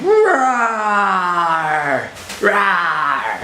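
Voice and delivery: mock monster roar for a toddler; hammed-up roar at play time; playful monster roar. Playing monster game with child.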